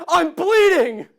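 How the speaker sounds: huge high-pitched shout, retold for comedy; hammed-up full-volume yell. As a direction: blast it out in mock panic. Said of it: "I shouted".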